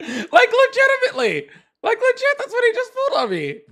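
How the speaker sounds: high, laughing disbelief. Real, not acted. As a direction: giggle through the words. Still laughing at caller.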